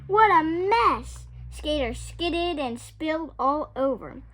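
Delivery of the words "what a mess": exclaiming. A narrator's reading. Narrator on the chaos.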